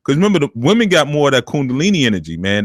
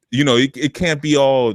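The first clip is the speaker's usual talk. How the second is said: usual speaking voice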